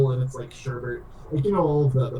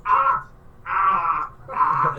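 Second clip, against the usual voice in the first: short cries of pain, first one high; pained yelps; 4 semitones higher, much slower than usual. Acted. Player voicing Usador twisting his neck, pained yelps. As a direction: yelp as the neck cracks.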